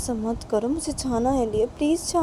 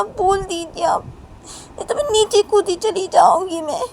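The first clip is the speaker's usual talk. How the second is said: put-on higher, tearful child's tantrum; crying tantrum; 4 semitones higher than usual. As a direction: wail at papa through tears, higher. Crying child pleads with father. (ऊँची, रोती हुई बच्ची की ज़िद)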